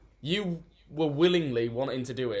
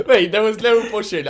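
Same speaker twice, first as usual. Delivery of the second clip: laughing; 5 semitones higher, 7 dB louder than usual. Real, not acted. Laughing denial of pushing friend.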